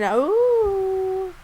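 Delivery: long, loud, high "ooh"; excited "ooh". Real, not acted. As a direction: let out a drawn-out "ooh" that swoops up high. Reacting to Sebastian overtaking Shane in hearts.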